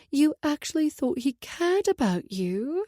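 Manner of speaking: high, slow, taunting disbelief; mocking pout. A narrator's reading. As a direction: drag it out like a sneering pout. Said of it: "She pouted".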